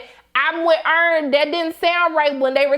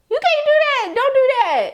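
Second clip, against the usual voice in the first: laughing scold; 10 semitones higher than usual. Real, not acted.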